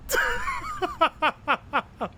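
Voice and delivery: squealing embarrassed laugh; high shrieking laugh. Real, not acted. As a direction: break into a shrill laugh.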